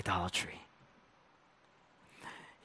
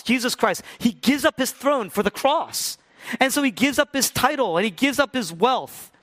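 climbing higher and louder; building sermon; 11 semitones higher, 9 dB louder than usual. Real, not acted. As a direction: rise toward the climax. Preaching climbs to climax.

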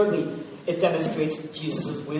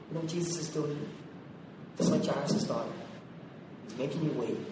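ordinary delivery

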